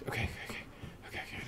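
whispered okays; breathless soft okays; panicked whisper. Real, not acted. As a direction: whisper to steady yourself. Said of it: "Don't panic".

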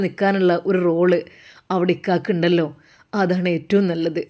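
son calmly agreeing with his mother on the phone. Acted. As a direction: drop down and speak gently as the son.

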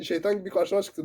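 ordinary delivery

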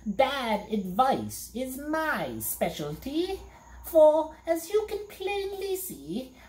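high, slow gloating fiend; a high put-on monster brag; boasting monster. A narrator's reading. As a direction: boast like a fearsome beast. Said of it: "he replied".

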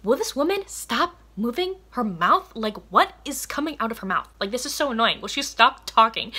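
hammed-up irritated caveman rant; annoyed caveman. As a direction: grumble at the woman who won't stop talking. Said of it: "and he's like".

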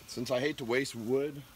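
normal talking voice

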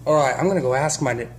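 ordinary talk, nothing marked

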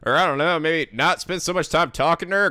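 ordinary delivery

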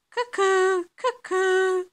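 high, loud, hooted cuckoo; cuckoo call. A narrator's reading. Living room clock striking at night, high cuckoo imitation.

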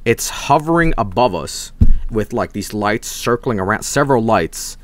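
ordinary talk, nothing marked

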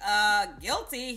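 slow, drawn-out sheepish guilty; playful admission. Retold or imitated. Owning up to the Dior salesman, slow and sheepish. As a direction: own up with a coy shrug in the voice. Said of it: "I was like".

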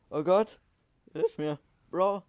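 loud panicked plea for help; panicking gamer. Real, not acted. Panicking mid-fight, calling for help.

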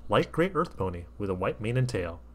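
usual speaking voice

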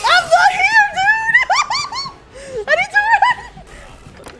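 very high, loud yelling with laughter; hysterical, high-pitched shouting; laughing panicked shriek. Real, not acted. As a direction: shriek while trying to escape. Panicking while chased.

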